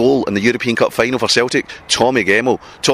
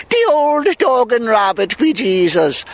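put-on stagey Irish pub-goer accent, pitched up; mock Irish accent; 10 semitones higher, slower than usual. Pub regular pretending to be Irish, mock Irish accent. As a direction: ham it up as a fake Irishman in a hammed-up Irish accent. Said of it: "suddenly becomes an Irish pub".

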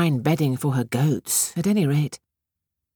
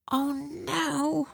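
soft, high inner dismay; hushed worried thought; 13 semitones higher, 7 dB quieter than usual. A narrator's reading. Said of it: "Batushka thought".

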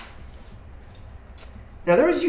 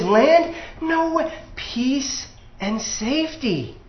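higher, breezy, reassuring brush-off; smooth it over like a flatterer calming a crowd; soothing false prophet; 6 semitones higher, 3 dB louder, slower than usual. Shown as a demonstration. Preacher mimicking the false prophets, light and reassuring. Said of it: "false prophets ... had a fairly consistent message that went something like this".

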